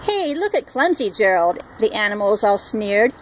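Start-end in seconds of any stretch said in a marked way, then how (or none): none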